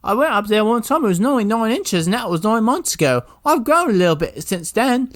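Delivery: put-on high squeaky kid voice; small chimney boy. Chimney boy answering his boss, high squeaky kid voice. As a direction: play a cramped little sweep, voice pitched high. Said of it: "They're like".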